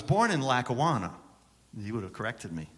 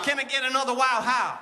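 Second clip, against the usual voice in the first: preacher call-out; 11 semitones higher, 4 dB louder than usual. Pretend. Call and response with crowd.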